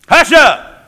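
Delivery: loud, high, sudden order; silencing command. Acted. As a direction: shout them into silence. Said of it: "Be quiet".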